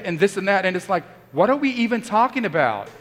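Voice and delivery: usual speaking voice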